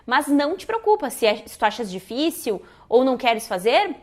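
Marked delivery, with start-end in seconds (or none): none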